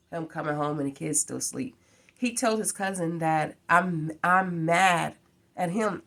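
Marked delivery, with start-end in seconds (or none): none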